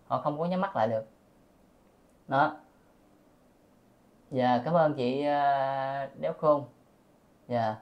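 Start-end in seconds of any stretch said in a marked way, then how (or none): none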